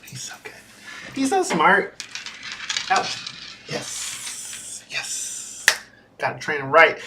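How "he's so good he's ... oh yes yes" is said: hushed praise to the dog; soft cooing. Real, not acted. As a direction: murmur it fondly.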